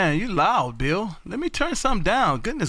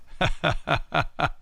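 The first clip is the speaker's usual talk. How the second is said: softer, low run of chuckles; amused laugh; 5 semitones lower, 3 dB quieter than usual. Real, not acted. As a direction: chuckle in amazement. Laughs at the surprising count.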